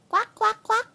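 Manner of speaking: playful high quacking like a duck; duck quacks. Pretend. Mom quacking at her baby to show the duck, playful duck sounds.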